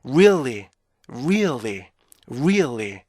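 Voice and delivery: "really" as ree-ul-lee, middle rising then falling; slow three-beat model of "really"; pitch-arc REALLY. Shown as a demonstration. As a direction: lift the hidden "ul" in "really". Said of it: "이렇게 읽어주셔".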